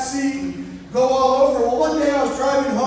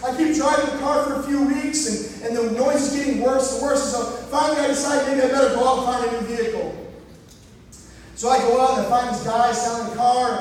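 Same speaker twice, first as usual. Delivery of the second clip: ordinary talk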